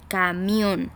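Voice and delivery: "Camión" drawn out, weight on MIÓN; stressed last syllable. Shown as a demonstration. Teacher showing where the voice loads, stressed MIÓN in "Camión". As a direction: stretch "Camión" and lean on the final MIÓN. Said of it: "en la última sílaba".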